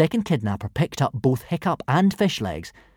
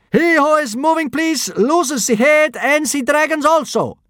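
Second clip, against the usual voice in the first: big high yell in broken Norse; 6 dB louder than usual. A narrator's reading. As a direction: bellow it like a clumsy foreign soldier. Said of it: "yelled the first kidnapper, speaking very badly in Norse".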